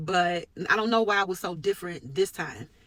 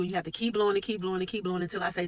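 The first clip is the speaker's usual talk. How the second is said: ordinary delivery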